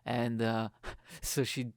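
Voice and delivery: normal talking voice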